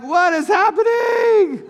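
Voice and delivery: stunned family members shouting in disbelief; shocked outcry. Retold or imitated. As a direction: yell it, totally baffled. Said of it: "We're like".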